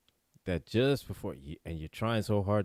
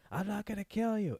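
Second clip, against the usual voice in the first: high falsetto madman voice; mock-crazed, pitched way up; crazed pursuer; 13 semitones higher than usual. Quotes crazed McCoy running at him.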